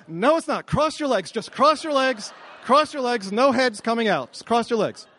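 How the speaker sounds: high-pitched, insistent pleading; frantic denial. Retold or imitated. Husband begging wife to hold the baby in, frantic. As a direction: order it over and over in mock panic.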